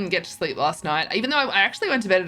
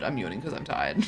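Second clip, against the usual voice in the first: tired; 8 semitones lower, 6 dB quieter than usual. Real, not acted. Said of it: "I'm yawning 'cause I'm tired".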